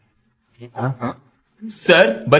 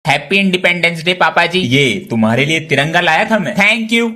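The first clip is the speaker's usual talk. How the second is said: ordinary delivery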